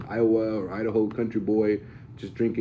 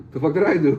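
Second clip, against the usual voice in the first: baffled outburst; 7 semitones higher, 6 dB louder than usual. Acted. Acting out a baffled politician.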